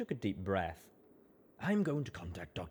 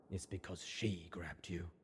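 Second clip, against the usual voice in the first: whispered, softer; soft murmur; 6 dB quieter than usual. Acted. Rampage softly naming the truth, whispered. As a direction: breathe it gently as a whisper. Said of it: "she murmured softly".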